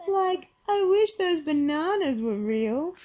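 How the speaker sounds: high, slow, drawn-out wistful wail; high-pitched goofy sidekick, wishing aloud; sighing Shaggy. Acted. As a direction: sigh it out long and high. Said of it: "Shaggy sighed".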